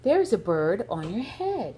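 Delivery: plain reading voice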